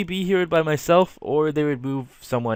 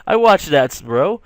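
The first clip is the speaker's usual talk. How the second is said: much higher and louder, an overeager cool-bro act; hammed-up cool guy; 7 semitones higher, 5 dB louder than usual. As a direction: oversell the enthusiasm with a thumbs up. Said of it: "And I was like".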